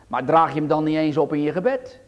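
ordinary delivery

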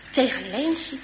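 plain reading voice

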